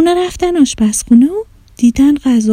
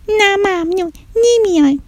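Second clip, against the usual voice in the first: put-on stubborn child; 9 semitones higher than usual. Child refuses to come inside.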